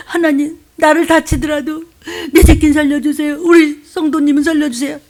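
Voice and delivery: acted sobbing plea; tearful plea. Acts pastors pleading to God.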